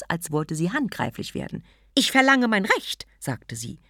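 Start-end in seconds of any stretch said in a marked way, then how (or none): 1.9-3.1 loud, high insistent demand; angry. Acted. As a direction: demand it hotly. Demanding her right.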